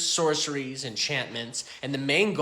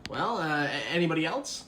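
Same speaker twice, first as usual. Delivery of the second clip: ordinary talk, nothing marked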